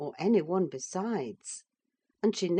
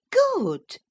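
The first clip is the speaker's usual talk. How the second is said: high, loud exclamation; cried out; 9 dB louder than usual. A narrator's reading. Catherine breaking off the quarrel, crying out. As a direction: cry it out sharply. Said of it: "cried the other".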